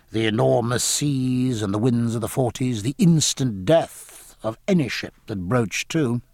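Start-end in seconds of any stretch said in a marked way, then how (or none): none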